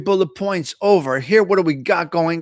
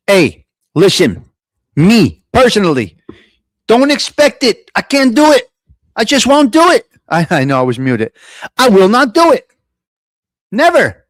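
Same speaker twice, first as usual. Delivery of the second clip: loud, defiant refusal; shouting; 7 dB louder than usual. Real, not acted. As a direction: belt out the refusal.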